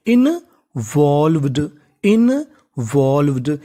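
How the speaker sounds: Involved stretched out very slowly and carefully; slow teaching demo. Shown as a demonstration. Teacher to students, slowly sounding out a word. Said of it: "और आखिरी में लिखा है वीईडी।".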